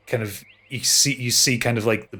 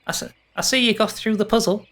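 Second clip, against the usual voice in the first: put-on high boyish satyr voice; 10 semitones higher than usual. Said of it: "he goes".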